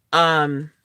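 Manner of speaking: usual speaking voice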